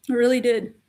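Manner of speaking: normal talking voice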